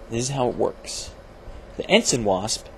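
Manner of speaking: usual speaking voice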